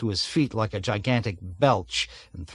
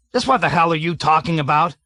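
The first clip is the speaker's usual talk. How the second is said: loud, higher barked rebuke; angry shout; 5 semitones higher, 5 dB louder, faster than usual. A narrator's reading. Colonel shouts down Major Metcalf angrily. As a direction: tear into him.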